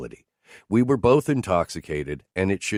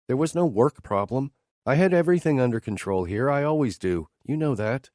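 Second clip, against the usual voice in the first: higher, lightly voiced hurt woman; woman complaining to her boss, a little higher; Teresa's voice; 7 semitones higher than usual. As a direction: play the wounded colleague, a bit higher. Teresa speaks.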